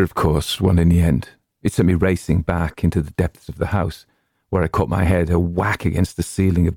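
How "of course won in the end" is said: ordinary delivery, nothing marked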